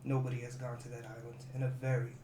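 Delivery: soft, low-volume monk voice; a quiet monk's caution; hushed monk warning. Acted. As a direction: warn them quietly, gravely.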